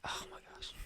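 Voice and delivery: hushed retold aside; dismayed whisper. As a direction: whisper it under your breath in disbelief. Retold shocked aside to self.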